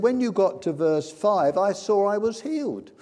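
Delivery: loud, high, building to a shout; imitated jubilant proclamation; triumphant testimony. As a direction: drive the retold testimony to its climax. Climax of healed woman's testimony.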